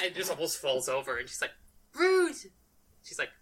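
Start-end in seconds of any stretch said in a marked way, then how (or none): 1.9-2.3 high, loud mock indignation; hammed-up affronted outburst; indignant cry. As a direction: yelp it in offended protest. Voicing tripped character's protest.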